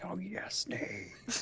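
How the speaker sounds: slow breathy whisper coaxing. Retold or imitated. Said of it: "I'm like".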